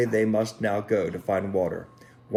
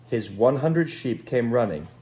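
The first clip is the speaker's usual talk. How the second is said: usual speaking voice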